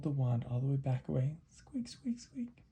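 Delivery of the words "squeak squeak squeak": tiny, soft high squeak; whispery high mouse noise. Acted. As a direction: squeak very quietly like a little mouse. Mouse drags the wand away.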